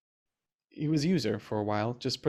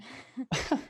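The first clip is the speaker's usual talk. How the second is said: high, airy nervous laugh; shy laugh; 12 semitones higher than usual. Acted. John laughs shyly after calling her wonderful. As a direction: laugh off your own confession.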